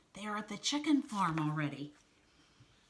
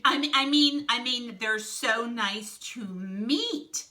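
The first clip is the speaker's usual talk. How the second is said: loud stammered restart, then stretched-out MEET; flustered correction, slowing right down on "meet"; covering a slip; 4 semitones higher, 8 dB louder, slower than usual. Acted. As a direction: blurt "I mean", then slowly lean on "meet". Snake hastily corrects his slip.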